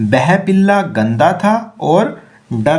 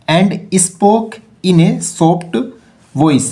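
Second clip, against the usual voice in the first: very slow, deliberate reading; slow read-aloud; much slower than usual. Shown as a demonstration. Teacher rereading the line to pupils, slowly. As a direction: spell it out slowly for learners.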